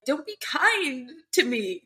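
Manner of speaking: lightly acted weeping plea; mock crying. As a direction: say it as if choking back sobs. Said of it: "She is crying so badly".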